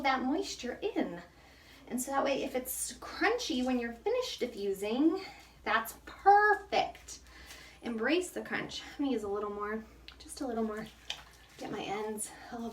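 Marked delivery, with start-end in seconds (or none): 5.6-7.2 high, loud, very slow delight; a loud, slow, delighted cheer; drawn-out delight. Real, not acted. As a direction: stretch it out, thrilled. Praising crunchy hair.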